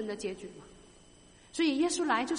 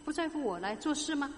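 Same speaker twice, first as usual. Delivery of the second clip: usual speaking voice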